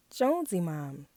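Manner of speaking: plain reading voice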